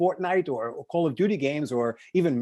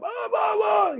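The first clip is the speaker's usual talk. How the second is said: mock Tony Robbins yell; slower than usual. Speaker mimics Tony Robbins pumping up a crowd, hammed-up yell. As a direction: imitate a speaker screaming to pump up the room. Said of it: "He's yelling and screaming at the crowd".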